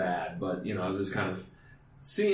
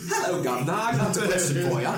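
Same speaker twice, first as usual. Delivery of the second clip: hammed-up British accent, pitched higher; 8 semitones higher, 4 dB louder than usual. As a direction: put on a jokey British accent. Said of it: "should have been in a British accent".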